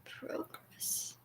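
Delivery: "Progress" whispered, first vowel "oh", as in proh-gress. Shown as a demonstration. Trying the Canadian vowel on "Progress", under the breath. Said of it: "I say progress".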